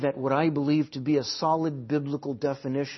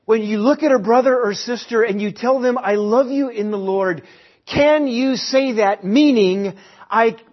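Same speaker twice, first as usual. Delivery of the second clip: higher and louder, driving the point; full-force preaching; 7 semitones higher, 7 dB louder than usual. Acted. Pastor urging the church, higher and louder preaching. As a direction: preach it hard to the room.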